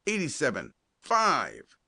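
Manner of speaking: high-pitched quarterback's call, falling at each end; calling out signals. A narrator's reading. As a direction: sing out the play numbers to the team. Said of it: "cried Colton".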